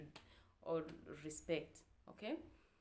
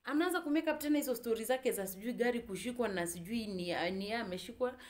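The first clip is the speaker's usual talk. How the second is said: raised, loud indignant complaint; exasperated indignation; 5 semitones higher, 7 dB louder than usual. Real, not acted. Telling viewers he skipped the pastor appointment, loudly indignant. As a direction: vent in hurt disbelief, pitch jumping up. Indignant retelling of being stood up.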